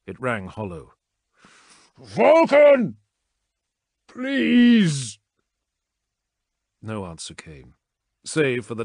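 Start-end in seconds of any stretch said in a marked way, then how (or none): 2.0-5.2 loud, much higher pleading cry; desperate cry. A narrator's reading. Son begging his dead father, crying out. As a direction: call out to a father who won't answer.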